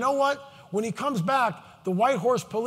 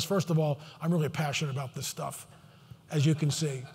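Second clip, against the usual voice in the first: low, hushed voice; 5 semitones lower, 7 dB quieter than usual. Real, not acted. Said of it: "the reason why I'm talking like this".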